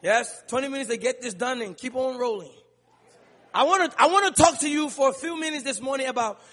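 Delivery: ordinary talk, nothing marked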